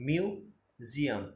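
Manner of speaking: মিউজিয়াম very slowly, split "miu... ziam"; মিউজিয়াম sounded out slowly with a break after "miu"; syllable-by-syllable pronunciation. Shown as a demonstration. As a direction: say মিউজিয়াম in two drawn-out parts. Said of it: "মিউ বানান কিন্তু".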